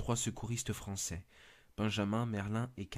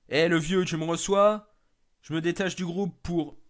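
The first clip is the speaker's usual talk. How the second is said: higher, louder call over the radio; 7 semitones higher, 8 dB louder than usual. Acted. Benjamin calls the old man at base on the radio, loud. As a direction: hail the base to get an answer.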